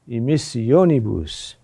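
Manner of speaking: emissionibus, hitting the o, then falling; stress on third-to-last syllable. Shown as a demonstration. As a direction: punch the o in emissionibus. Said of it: "There, the accent is there".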